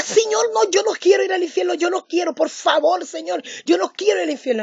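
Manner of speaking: high, fast pleading cry; terrified begging. Retold or imitated. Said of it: "le grité al Señor". (ruego desesperado, agudo y rápido)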